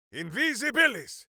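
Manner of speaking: high, bright incantation; spell call. Acted. Minsk casting invisibility, calling the spell. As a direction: sing out the spell word with flair.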